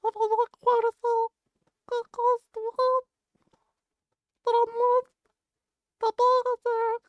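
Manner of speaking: very high squeaky little creature voice; Jinjo voice. Pretend. Chat asks for the quote in Jinjo voice, very high squeaky creature. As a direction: squeak it out like a tiny video game critter. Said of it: "Read it in Jinjo voice?".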